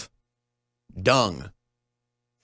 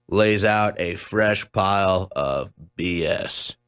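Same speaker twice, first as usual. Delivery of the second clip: slow scornful; 4 semitones lower, slower than usual. Real, not acted.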